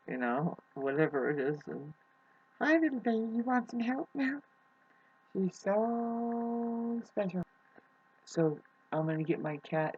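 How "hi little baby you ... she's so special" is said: high, tender cooing; high-pitched pet-talk voice. Real, not acted. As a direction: coo to a little kitten, drawing the vowels out. Cooing to her kittens.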